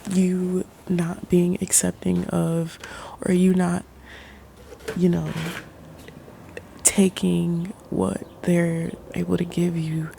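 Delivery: normal talking voice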